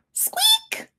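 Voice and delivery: very high squeak. Acted. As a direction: squeak like a little mouse. Squeaky noise in story.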